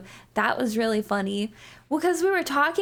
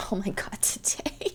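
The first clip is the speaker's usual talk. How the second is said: whisper; 6 dB quieter than usual. Real, not acted. Laughing aside, whispered.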